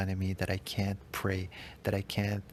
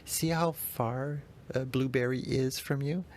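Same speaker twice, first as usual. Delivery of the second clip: imitating daughter; 5 semitones higher than usual. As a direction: voice a little girl asking. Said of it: "she put it there and s".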